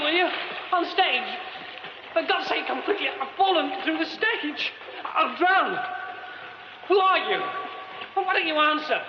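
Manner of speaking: trapped man shouting up to a stranger on the stage, frantic pleading calls. Acted. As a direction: shout up to the stranger, urgent and scared. Calling to an unseen stranger.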